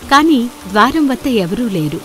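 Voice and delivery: ordinary delivery, nothing marked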